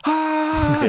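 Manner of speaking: high, loud imitated belting; mock belted note. Dad imitating his daughter at the concert, a high mock belted note. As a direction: belt it like a kid on stage. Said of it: "singing as loud as she could".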